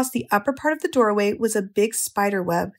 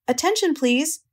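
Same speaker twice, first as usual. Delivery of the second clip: higher, mildly firm announcement; loud, firm voice; 6 semitones higher than usual. A narrator's reading. Pig addressing the whole barn, mildly loud and firm. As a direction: call for attention, a touch firm and raised in pitch. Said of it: "he said in a loud, firm voice".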